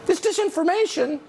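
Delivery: high mimicking lilt; mock sing-song pundit. Host imitates the pundit crying disinformation, mock sing-song. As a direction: parody her scolding in a high sing-song. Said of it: "in a sing-songy way".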